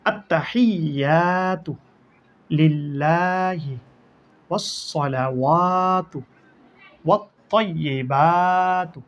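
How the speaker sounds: আত্তাহিয়াতু slow and exact, with no extra stretch; correct recitation. Shown as a demonstration. Teacher showing the correct reading after a common mistake, slow and exact. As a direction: model the right way, word by word. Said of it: "আবার বলি".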